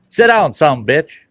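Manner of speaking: high, loud parrot order; rude parrot. Acted. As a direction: bark it out like a cussing parrot. Parrot barks at preacher.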